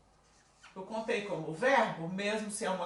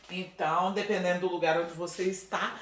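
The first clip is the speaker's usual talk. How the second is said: normal talking voice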